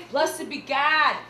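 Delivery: loud, slow declaiming with Midwestern vowels; Midwestern accented stage line. Retold or imitated. Beggar woman's only line in a play, Midwestern accent. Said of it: "my only line".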